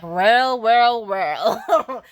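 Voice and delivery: loud, gloating well well well with a laugh; loud smug cackling Uncle Ruckus; a gloating old cartoon grump, laughing; Uncle Ruckus impression. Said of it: "the Uncle Ruckus in the background".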